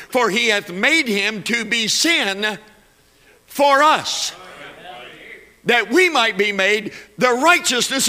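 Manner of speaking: a pastor pleading with unbelievers; fervent preaching. Real, not acted. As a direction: preach it hard to the congregation. Preaching at full force.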